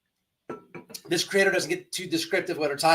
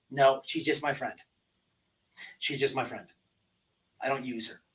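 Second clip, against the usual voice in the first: quiet pointed; 5 semitones lower, 8 dB quieter than usual. Real, not acted. Pointed repetition, dropping.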